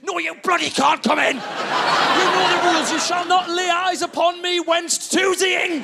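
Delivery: high, slow mock-grand decree; indignant refusal. Husband barring wife from bathroom, indignant. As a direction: proclaim the rule with drawn-out outrage.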